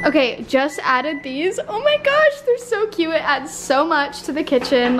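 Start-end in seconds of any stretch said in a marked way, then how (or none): 1.7-3.1 high gush of delight. Real, not acted. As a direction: squeal how cute. Delight over decorations.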